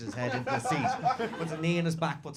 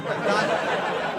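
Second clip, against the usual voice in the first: ordinary talk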